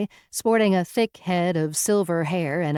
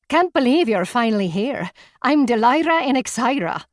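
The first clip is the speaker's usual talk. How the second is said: put-on higher, louder, eager young woman; bubbly excitement; 6 semitones higher, 4 dB louder than usual. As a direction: gush with bright excitement. Bubbly woman greets them eagerly.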